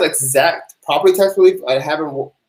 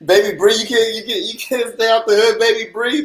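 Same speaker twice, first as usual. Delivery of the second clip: teasing singsong; 7 semitones higher than usual. Real, not acted. Teasing a viewer by name.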